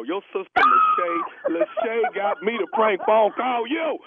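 laughing. Real, not acted. Reveal of prank, laughing.

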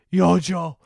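mock drunk call, too loud; loud drunk friend. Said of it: "cause he's so loud".

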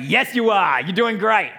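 loud cheering praise; praising. Retold or imitated. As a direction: cheer her on. Praising daughter after jump.

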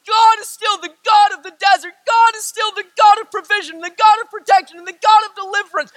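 high, loud building proclamation; rally the church with each line; fiery sermon climax; preaching at full force. Real, not acted.

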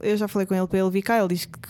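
ordinary talk, nothing marked

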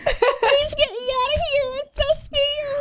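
high, slow, whimpering imitation; high mock scared copy. Friend repeats Nikki's line in a high, slow, mock-scared voice. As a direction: wail it like a trapped little voice.

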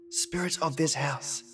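slow, hushed medium summoning spirits; seance medium. Acted. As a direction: intone it like an old-fashioned medium. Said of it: "why people thought ghosts talk like serfs".

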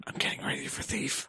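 sneaky whisper. Pretend. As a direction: whisper like a thief. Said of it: "Why are we whispering?".

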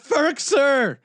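shouting a player's name; retold yell. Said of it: "I just got up and yelled".

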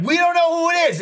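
high, loud clueless voice; mock Trevor. Imitating Trevor's clueless guess, high and loud. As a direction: play dumb up high. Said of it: "Trevor's just like".

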